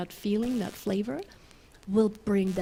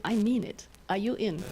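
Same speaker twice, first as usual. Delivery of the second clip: ordinary delivery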